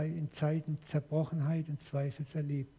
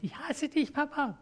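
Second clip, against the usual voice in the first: put-on high child's voice shouting at his father; angry little son; 11 semitones higher than usual. Son pounding on his father's chest, high childish outburst. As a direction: play a small boy raging at his dad, much higher. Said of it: "mein einer Sohn mal so gegen meine Brust getrommelt".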